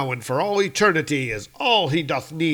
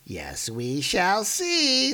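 Geroder's voice; 8 semitones higher, much slower than usual. Acted. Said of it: "says Geroder".

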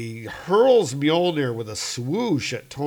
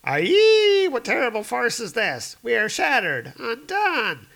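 trolls wailing; 11 semitones higher, 5 dB louder than usual. Acted. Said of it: "And they're like".

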